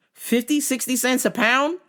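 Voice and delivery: normal talking voice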